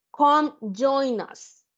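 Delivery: drawn-out friendly invitation; slow inviting call. Retold or imitated. Teacher acting out inviting Yulisa into the group slowly. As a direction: invite a classmate, stretching the words. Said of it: "Yo vengo y le digo".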